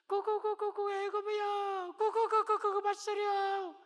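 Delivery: high, slow, loud cooing dove voice; foolish dove cooing. Retold or imitated. Preacher mimics a foolish dove calling for Egypt, high loud cooing. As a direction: coo it out like a silly bird flapping off.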